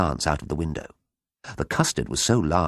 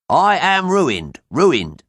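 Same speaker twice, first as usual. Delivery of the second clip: high, loud, slow theatrical lament; bitter mock sob; 10 semitones higher, 6 dB louder, much slower than usual. A narrator's reading. Quoting the ruined farmer from the newspaper, hammed-up bitter sob. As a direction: wail it as quoted misery. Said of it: "sobbed Mister Bassett bitterly".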